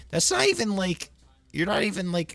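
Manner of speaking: can't believe it; high-pitched outrage; incredulous squeak. Real, not acted. Indignant about mother's age.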